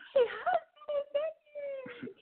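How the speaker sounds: very high, drawn-out tearful wail; imitated screaming. Imitating the actress fighting to get in, high mock wail. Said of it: "screaming at the top of her lungs".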